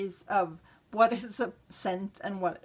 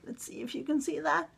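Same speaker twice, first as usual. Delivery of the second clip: quick unvoiced whisper; 4 semitones higher, 4 dB quieter, faster than usual. Real, not acted. Showing bottle design on camera.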